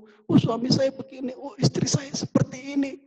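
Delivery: high-pitched mock lament; acted complaining. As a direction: imitate a spouse pouring out grievances. Acting out spouses' complaints in prayer.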